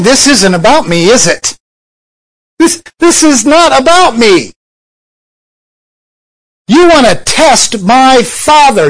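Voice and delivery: preacher voicing Jesus in rising rebuke; Jesus confronting Satan. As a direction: press the point hard as Jesus.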